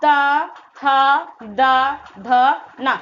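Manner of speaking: ता, था, दा, ध very slow, each vowel held; articulation demo. Shown as a demonstration. Teacher showing the ता वर्ग's place, ता, था, दा, ध stretched out. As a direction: draw out ता, था, दा, ध, then a short ना. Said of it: "ता वर्ग का जो उच्चारण स्थान होगा".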